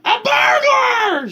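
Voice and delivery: high, loud, slow alarmed cry; frightened gasp. Acted. Martha discovering a burglar, high frightened cry. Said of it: "she gasped".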